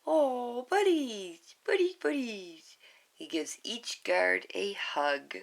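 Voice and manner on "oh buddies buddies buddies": high minion voice fading to a whisper; happy sigh. Acted. Bob happily sighing as he hugs each guard. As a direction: sigh it out fondly like a little minion. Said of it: "Bob sighs happily".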